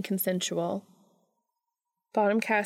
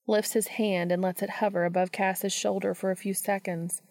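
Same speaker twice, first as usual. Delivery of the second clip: plain reading voice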